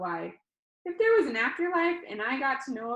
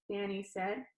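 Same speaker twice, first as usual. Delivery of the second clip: plain reading voice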